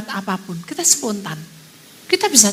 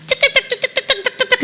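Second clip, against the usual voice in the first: rapid high-pitched gecko chatter; gecko chirping; 12 semitones higher than usual. Retold or imitated. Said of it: "cecak ini berbunyi cik-cak".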